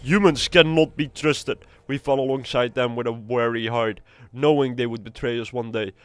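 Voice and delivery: reading the orc commander's quest text aloud. Acted. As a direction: lend the quest giver some weight.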